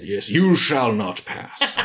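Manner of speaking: slow mock wizard decree, laughing; Gandalf quote. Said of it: "Lord of the Rings quotes".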